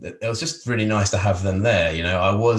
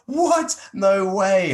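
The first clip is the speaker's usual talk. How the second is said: much higher and louder, mock astonishment; amazed; 12 semitones higher, 6 dB louder, much slower than usual. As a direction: squeal it like she can't believe it. Art friend amazed at painting.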